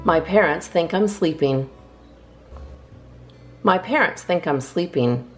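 ordinary delivery, nothing marked

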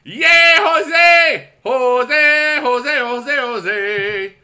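high, loud chant of the name, fading out; shouting a name. Pretend. Giving a fan his requested shout-out, loud and high. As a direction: bellow the name like a crowd chant, then let it fade. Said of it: "please shout out my name is Jose".

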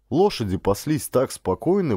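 ordinary delivery

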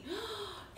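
soft, high frightened gasp; mock gasp. Scared animals hearing the lion, soft gasp.

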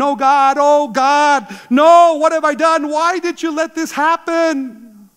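preacher reenacting a mother's panicked screams, half-performed; retold screaming. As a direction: cry out in panicked horror, the last question slow and strained. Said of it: "running and screaming".

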